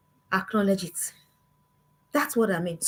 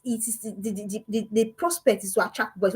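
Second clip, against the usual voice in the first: usual speaking voice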